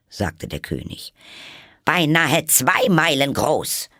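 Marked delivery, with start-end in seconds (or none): 1.9-3.9 high, loud, slow haughty queen; shrill queen. Acted. Queen insists.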